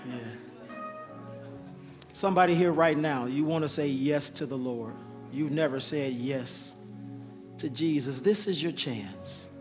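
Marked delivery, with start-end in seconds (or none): none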